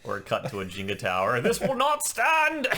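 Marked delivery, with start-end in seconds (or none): 1.4-2.7 high, loud, drawn-out bellow; mock outrage. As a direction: belt it out as hammed-up indignation. Mimics the outraged character's line.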